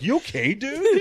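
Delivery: you okay dude, rising high on dude; concerned host. Retold or imitated. Mock concerned host asking the fallen comic. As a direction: play the worried host.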